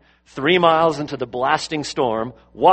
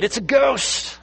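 frightened cry; 10 semitones higher, slower than usual. Retold or imitated. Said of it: "they were terrified and said".

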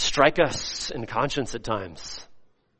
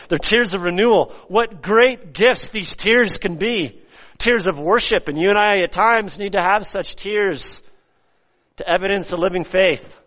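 high, swelling sermon voice; fervent preaching; 8 semitones higher, 5 dB louder than usual. Real, not acted. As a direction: lift it up in fervent exhortation. Preaches at full force.

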